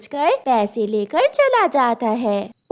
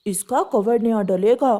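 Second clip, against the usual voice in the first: a hint of an older shopkeeper; matter-of-fact repairman; pigeon shopkeeper; 3 semitones lower than usual.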